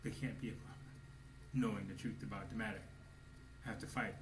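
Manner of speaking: usual speaking voice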